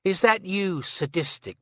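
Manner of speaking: lightly imitating a drawling man; mild mock drawl. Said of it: "in a sort of drawly voice".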